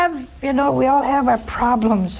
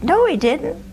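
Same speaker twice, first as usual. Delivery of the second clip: indignant denial; 8 semitones higher than usual. Retold or imitated. Retold protest to court clerk.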